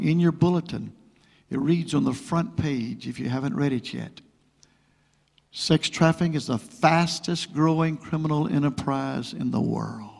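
usual speaking voice

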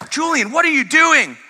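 high, loud parental outburst; retold exasperated yell. Dad catches son banging a cup, retold exasperated yell. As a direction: cry out as an alarmed dad catching mischief.

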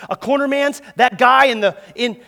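ordinary talk

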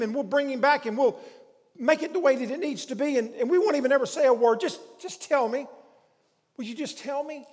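loud, high, urgent bargaining; acted, imploring appeal at full voice; Mary's desperate plea. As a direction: plead insistently, words tumbling out in bursts. Mary acted pleading with gardener.

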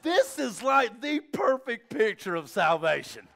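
loud, high, awestruck; nearly shouting with wonder; overwhelmed. Real, not acted. Preacher overwhelmed at baptism.

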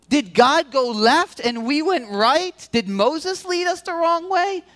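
put-on high, pleading cry of a parent with dying kids; desperate complaint to God. Parent with thirsty kids calling out to God, high and pleading. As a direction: throw the question at God, almost wailing. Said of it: "You'd be like".

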